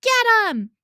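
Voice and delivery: loud high scream. A narrator's reading. Bully screaming at his gang to chase, loud. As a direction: scream the attack order. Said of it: "someone else screamed".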